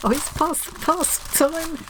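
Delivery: high, catching voice, moved; choked up. Real, not acted. Crafter overcome opening a friend's gift, choked up. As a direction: voice climbing as emotion rises. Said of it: "I'm getting all choked up now".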